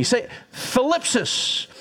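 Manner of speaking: thlipsis said slowly, with a lisped th; slow Greek word. Shown as a demonstration. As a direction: thlipsis drawn out syllable by syllable. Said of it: "say it with me".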